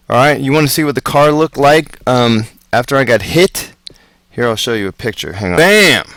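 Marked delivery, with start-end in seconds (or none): 5.6-6.1 sudden loud burst; loud exclamation. Retold or imitated. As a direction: shout it like an impact. Describing the car crash impact.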